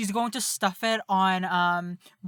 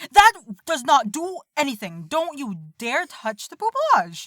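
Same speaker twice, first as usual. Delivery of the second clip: protective warning; 5 semitones higher, 5 dB louder than usual. Acted. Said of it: "I'm gonna be like".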